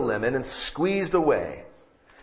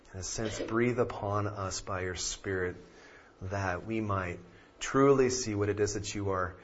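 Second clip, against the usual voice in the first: soft, hushed prayer voice; 3 semitones lower, 8 dB quieter than usual. Acted. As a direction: pray quietly and earnestly. Leads opening prayer.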